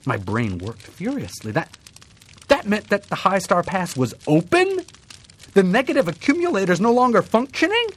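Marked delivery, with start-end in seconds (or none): 1.5-7.9 higher, louder, racing questions; alarmed thoughts. A narrator's reading. Narrator realizing the disaster, panicky and fast. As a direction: brain working furiously.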